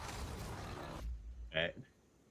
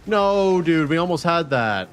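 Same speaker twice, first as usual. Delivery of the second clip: frustrated; 7 semitones higher, 4 dB louder than usual. Real, not acted. Just missed a kill.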